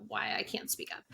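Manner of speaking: soft, breathy confession; whisper. Real, not acted. Telling her cohost she never complains: whispered admission. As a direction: whisper it as if embarrassed. Admitting she can't speak up.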